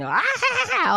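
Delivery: hammed-up manic laughter; mock crazy laugh. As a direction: laugh like a giddy maniac. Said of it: "looking crazy and happy".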